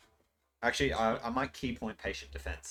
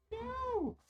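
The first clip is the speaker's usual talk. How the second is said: squeaky no; 5 dB quieter than usual. Acted. Sudden high mock-dismay.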